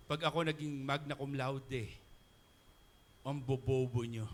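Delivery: hammed-up snobby graduate; mock arrogant boast.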